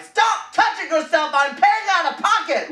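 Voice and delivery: high shrill mock yell of a fed-up mom; imitating mom scolding her son; nagging mom. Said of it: "my mom was driving me".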